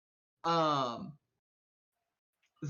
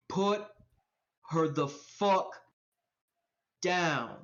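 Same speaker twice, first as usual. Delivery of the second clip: slow, heavily spaced words; menacing command; slower than usual. Acted. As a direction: lay down the order one piece at a time. Tough stranger repeats his order.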